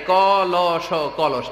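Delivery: ল স কলস spelled out slowly, letter by letter; slow spelling-out. Shown as a demonstration. Teaching children to spell কলস, slowly. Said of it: "শিখাই".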